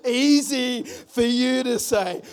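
slow, loud easy for you to say; sarcastic retort. Pretend. Believer grumbling at God, slow sarcasm. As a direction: draw it out with scorn. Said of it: "you know".

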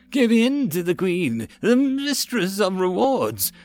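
high, slow, eerie chant; crazed cult yell. A narrator's reading. Possessed soldiers calling out to the Queen, eerie chant. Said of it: "they yelled".

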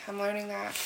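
soft, sighed under the breath; quiet sigh. Real, not acted. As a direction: let it out on a tired sigh. Sighs after repeating it.

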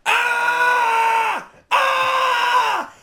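high, loud pained screams; wounded soldier screaming in agony; agonized scream. Retold or imitated. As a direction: scream like you've been shot.

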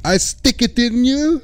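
very high, slow hammed-up Russian threat; cartoon Russian villain, drawn out; mock Russian accent. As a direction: stretch it out in a silly fake Russian accent. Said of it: "Teddy K G B".

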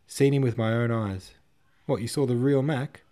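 loud, heated exchange of news; animated and emphatic; excited exclamation. A narrator's reading. Officers react to shock news.

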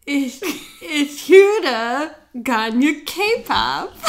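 very slow halting German with a laugh; slow learner attempt. Shown as a demonstration. Learner slowly repeating German, fighting laughter. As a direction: stumble through "Ich höre gerne K-pop" slowly, giggling. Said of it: "She's laughing at me".

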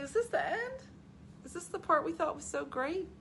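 high-pitched incredulous questioning; high, let-down disbelief; mock disbelief. Recalls disbelief at rewatching film.

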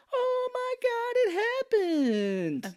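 hammed-up falsetto astonishment; mock amazement. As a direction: stretch it out in overblown wonder. Mark feigning amazement to the girl.

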